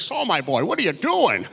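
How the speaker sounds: put-on old man's voice leaping high at the end; startled old animator. Old animator to student tugging the portfolio away, startled old man's voice. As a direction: imitate a baffled old-timer, pitch jumping up. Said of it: "tug of war with a seventy-five-year-old man".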